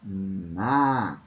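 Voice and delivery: na drawn out long and low; a long, low, held na; stressed syllable. Shown as a demonstration. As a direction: isolate na and stretch its vowel. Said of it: "é a sílaba".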